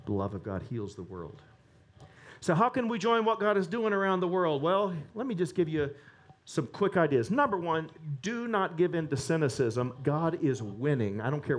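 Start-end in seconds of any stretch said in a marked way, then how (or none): none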